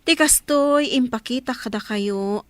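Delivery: normal talking voice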